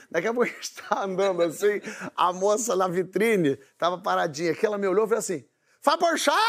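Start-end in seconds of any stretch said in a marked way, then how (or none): 5.8-6.4 high, astonished call of a name; starstruck recognition. Retold or imitated. Shop-window woman spotting a celebrity, mock astonished. Mulher reconhece o famoso na rua.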